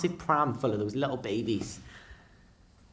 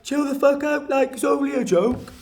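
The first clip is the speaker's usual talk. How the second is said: high squeaky put-on voice for Kev; mock him in a whiny squeak; 14 semitones higher, 7 dB louder than usual. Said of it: "He's like".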